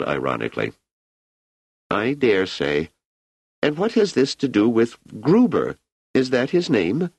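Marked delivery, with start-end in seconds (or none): 1.9-7.1 higher-pitched emperor, relaxed and chatty; an ageing emperor musing easily to an old friend; Emperor Cleon. Acted. Emperor Cleon musing to Selden about his age, higher and relaxed.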